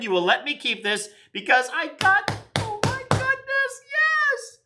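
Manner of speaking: high squealing delight; real excited shriek of yes. Real, not acted. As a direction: squeal with pure glee. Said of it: "I'm so excited".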